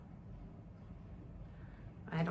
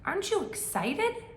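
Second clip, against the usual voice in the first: eager question; 11 semitones higher, 3 dB louder than usual. Acted. Bird asks eagerly.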